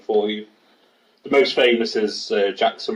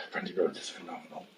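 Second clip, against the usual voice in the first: put-on dirty old man voice; 6 semitones lower, 17 dB quieter, faster than usual. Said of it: "That was a really dirty old man voice".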